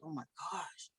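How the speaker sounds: very soft, low mutter; hushed exasperated aside. Retold or imitated. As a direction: mutter it to yourself in dismay. Weary aside about kids on live.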